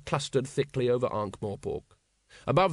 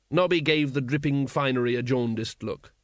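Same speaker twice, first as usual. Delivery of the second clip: ordinary delivery, nothing marked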